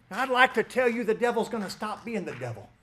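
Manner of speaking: normal talking voice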